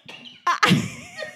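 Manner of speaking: high squeal of laughter; cracking up remembering the doctor. Real, not acted. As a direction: let a high laugh escape. Laughing at the memory.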